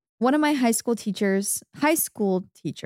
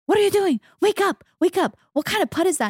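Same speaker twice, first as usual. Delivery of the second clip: imitated yelling mom; 10 semitones higher, faster than usual. Mom yelling at her son over a bad putt, high mock shout. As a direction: shout at the kid like a pushy golf mom. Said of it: "she starts yelling at him".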